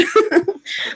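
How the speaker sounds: short laugh, pitch jumping up; real laugh; bright laugh. Real, not acted. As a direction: laughs at her own story. Laughs at the story.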